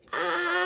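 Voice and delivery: a high, cut-off achoo; gigantic sneeze. Acted. Elephant giving a gigantic sneeze.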